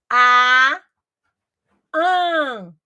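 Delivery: "a" said open and loud, then "an" through the nose; plain vs nasal vowel. Shown as a demonstration. Teacher showing that the nasal vowel comes out through the nose. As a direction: contrast "a" with a nasal "an". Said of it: "uma pronúncia que sai pelo nariz".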